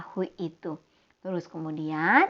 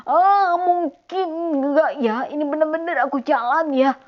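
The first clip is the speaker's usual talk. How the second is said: doubtful fear; 6 semitones higher, 6 dB louder than usual. Acted. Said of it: "dia mulai ada rasa takut".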